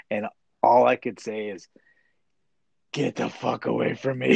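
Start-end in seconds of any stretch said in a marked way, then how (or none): none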